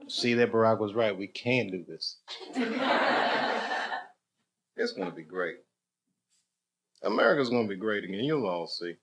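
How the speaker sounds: a hushed mock promise, tongue in cheek; mock hushed optimism. Mock Obama-era optimism, soft.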